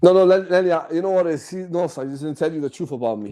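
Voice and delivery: normal talking voice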